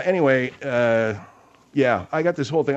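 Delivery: usual speaking voice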